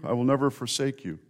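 usual speaking voice

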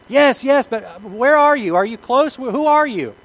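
high loud anxious call upward; calling back. Acted. Stranded man calls up to the unseen voice, loud and anxious. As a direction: shout up to the voice above.

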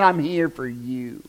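a still small voice; gentle voice of Jesus. Retold or imitated.

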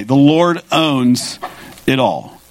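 "The Lord owns it all" drawn out slowly, weight on "Lord"; slow down and lean on "Lord owns"; slow emphasis. Real, not acted. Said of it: "this is what I want you to take away".

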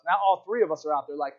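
ordinary talk, nothing marked

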